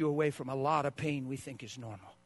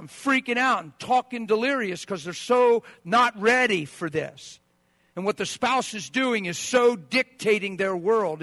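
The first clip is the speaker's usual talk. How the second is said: loud, climbing sermon pitch; preaching at full force; 5 semitones higher, 9 dB louder than usual. Real, not acted. Pastor painting a betrayal crisis, loud and driving. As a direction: drive the crisis home, louder and louder.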